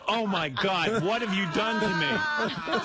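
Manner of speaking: high-pitched pretend shock; mock horror. Woman wakes and confronts the man, mock horror. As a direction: imitate a woman waking up appalled. Mimics horrified woman waking up.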